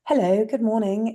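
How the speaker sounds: a light touch of a prim phone greeting; telephone voice. Retold or imitated. Imitating her own posh telephone voice for the listener. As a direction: answer the phone like a polished estate agent. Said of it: "You've got your telephone voice on".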